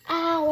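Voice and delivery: sharp ow of pain. Real, not acted. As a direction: yelp from a stubbed toe. Stubbed her toe on chair.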